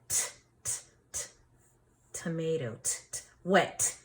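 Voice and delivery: t, t, said as short crisp taps; isolated t sound. Shown as a demonstration. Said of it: "If you said T".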